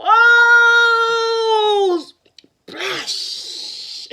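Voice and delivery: loud high cry. Acted. Lion falls in well.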